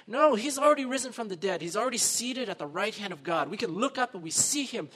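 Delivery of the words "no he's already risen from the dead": usual speaking voice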